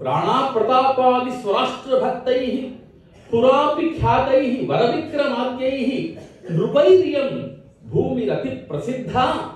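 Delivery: ringing, lilting chant of verse; Sanskrit verse recitation. Acted. Poet lamenting an occupied nation, chanted recitation. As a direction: declaim it with sustained line endings.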